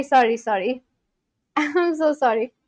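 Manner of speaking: ordinary talk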